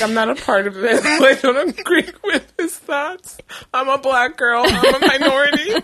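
high, pleading denial through laughter; hammed-up panic, cracking up as it climbs; laughing mock protest. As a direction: disown him in a high, giggling plea. Retold mock panic, disowning seatmate.

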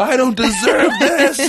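loud, high, drawn-out mock wail; hammed-up weepy cry, slow and high; mock bawling. Imitating his crying friend on the couch, loud mock wail. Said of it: "and he just cried".

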